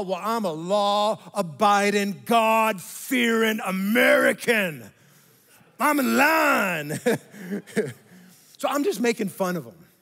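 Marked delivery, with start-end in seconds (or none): 0.0-7.0 slow, loud, puffed-up boast; mock pompous pride. Mocking his own self-righteousness.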